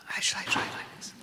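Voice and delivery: voiceless whispered demonstration. Shown as a demonstration. Actress with failing voice offers interviewer a whisper. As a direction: try it in a whisper. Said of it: "I could whisper".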